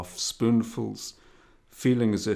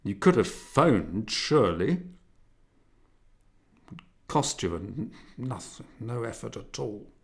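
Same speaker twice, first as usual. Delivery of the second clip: indignant father; 2 semitones higher, 4 dB louder than usual. A narrator's reading. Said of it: "in an indignant tone".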